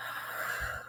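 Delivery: soft unvoiced out-breath; long exhale sigh. Shown as a demonstration. Coach showing viewers the breath, a long soft sigh. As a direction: breathe it all out audibly. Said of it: "Take another breath".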